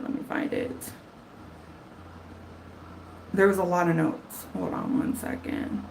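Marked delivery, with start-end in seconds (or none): 0.0-0.9 even softer whisper, trailing off; muttering to herself. Real, not acted. Still hunting through notes, a fading whisper. 4.5-5.8 whispered under the breath; whispered aside. Real, not acted. Asking viewers to wait while searching, whispered.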